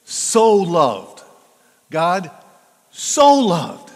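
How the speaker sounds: "so loved" rising to a strong stress on "so". Shown as a demonstration. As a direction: punch "so" harder each time. Preacher stressing key phrase.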